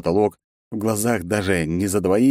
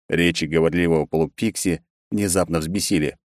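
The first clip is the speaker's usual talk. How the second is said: ordinary delivery, nothing marked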